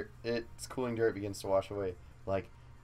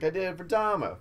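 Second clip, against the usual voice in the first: lightly voiced Stooge; 9 semitones higher, 4 dB louder than usual. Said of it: "He's like".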